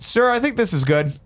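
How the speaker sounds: high, drawn-out polite request; strained politeness. Retold or imitated. Passenger to Uber driver, asking to stop, high and slow.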